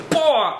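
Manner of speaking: a sharp imitated martial-arts yell; retold karate shout; kick yell. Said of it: "So Dan just goes".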